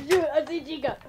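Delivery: high, loud frightened shout; alarmed. Real, not acted. Spots the monster in game.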